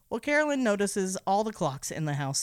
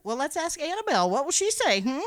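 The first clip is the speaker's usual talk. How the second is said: louder, higher teasing parent voice; mocking parent, louder; 9 semitones higher, 6 dB louder, faster than usual. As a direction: needle the kid like a smug mom. Mock parent teasing daughter.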